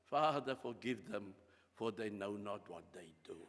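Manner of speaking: low, hushed prayer for mercy; Jesus pleading softly. Acted. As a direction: drop to a soft, low plea. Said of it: "then still he would say".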